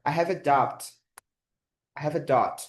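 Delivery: teacher modelling "doubt" with a mute b; silent b in doubt. Shown as a demonstration. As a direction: model "doubt" with no b sound, like dót. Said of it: "A gente pronuncia, esse B, ele é mudo".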